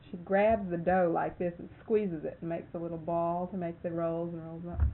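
ordinary delivery

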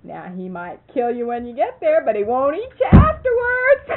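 high, loud, building to a laughing shout; laughing retort. Retold or imitated. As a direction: deliver the punchline, cracking up at the end. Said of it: "I says to him".

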